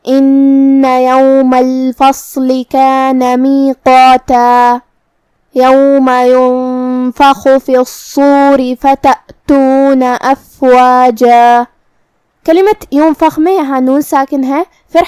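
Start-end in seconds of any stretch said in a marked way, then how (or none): 0.1-4.8 slow recitation ending on a stretched میقاتا; stop with madd. Shown as a demonstration. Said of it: "آخری میں اپنی آواز دو مد کے برابر لمبی". 5.6-11.6 slow chanted Quran verse ending on a stretched افواجا; drawn-out qari recitation; tajweed recitation. Shown as a demonstration. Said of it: "افواجہ پہ رکیں گے تو اس پہ مد الف سے رکیں".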